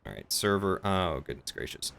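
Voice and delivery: ordinary talk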